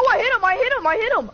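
high, loud triumphant yell; excited shout. Real, not acted. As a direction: cheer it out, thrilled. Celebrating a hit.

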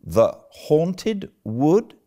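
very slow, rising, disbelieving question; astonished echo. Acted. Marilla astonished at Anne's haunted wood, incredulous. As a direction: echo it word by word, baffled.

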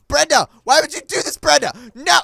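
high, loud mock-anguished cry; hammed-up betrayal; anguished blame. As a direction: wail the accusation at the culprit. Blaming Brenda for debt.

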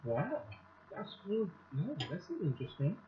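speaking between bites; talking while chewing. Real, not acted. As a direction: mouth partly full of food. Seen 0: Chews with closed lips before and between words; bowl nearby.

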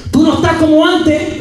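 high-pitched nagging reproach; imitating scolding wife. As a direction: mimic her telling you off, pitched right up. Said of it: "Carlos, yo te voy a hablar".

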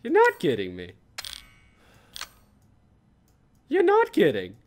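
loud to very high squeal; ecstatic disbelief. Real, not acted. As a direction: shout it in delight. Disbelieving joy building.

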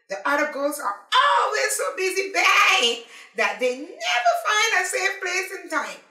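high, loud, eager chorus of little crabs; excited crabs. Acted. Crabs call out to Paddy, high and loud. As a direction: shout it out like thrilled small creatures. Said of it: "Then the crabs join in".

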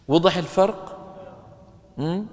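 usual speaking voice

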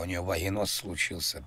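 usual speaking voice

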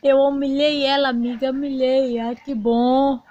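mais alto e mais agudo, em triunfo; triunfo animado. Acted. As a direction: comemore ter humilhado a rival. Comemora a humilhação com amiga. (conta à amiga, triunfante e eufórica)

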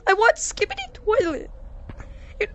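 high, whiny mock sob about suffering for the fans; mock crying sacrifice. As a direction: ham up the martyr, half crying. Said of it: "that's how much I care for you guys".

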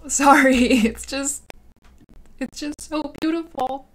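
high, teary voice breaking into laughs between sobs; crying and laughing at once over lost beauty; real crying. Real, not acted. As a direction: voice cracking with real tears. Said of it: "Oh, I'm gonna cry".

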